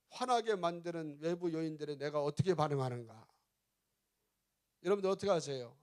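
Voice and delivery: normal talking voice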